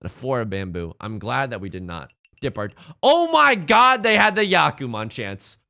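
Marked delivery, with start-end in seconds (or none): 3.0-5.6 loud, stretched-out disbelief; shocked. Real, not acted. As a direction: gasp at the near-disaster. Seeing the opponent's Yakuman chance.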